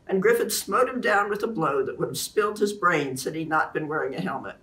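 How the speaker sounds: ordinary delivery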